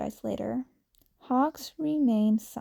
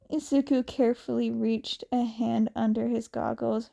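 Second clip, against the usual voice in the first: ordinary delivery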